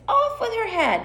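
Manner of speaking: high-pitched royal command; imperious Queen. Acted. Queen orders a beheading, high-pitched and imperious. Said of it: "And the queen says".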